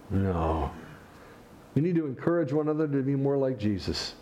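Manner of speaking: usual speaking voice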